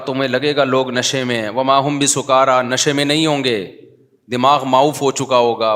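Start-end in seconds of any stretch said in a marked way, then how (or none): 1.5-2.6 louder chanted Arabic verse; Quran recitation. Acted. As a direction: recite it with full voice.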